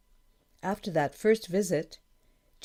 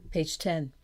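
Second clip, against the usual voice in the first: ordinary delivery, nothing marked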